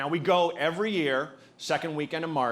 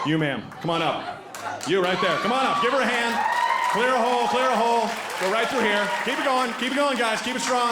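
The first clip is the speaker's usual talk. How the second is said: loud, high crowd-hyping calls; calling out; 7 semitones higher, 4 dB louder than usual. Real, not acted. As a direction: call out to the crowd. Calling audience member to stage.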